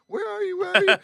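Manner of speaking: high, urgent where are you; mock frantic phone call; impatient tour guide. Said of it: "She's calling me".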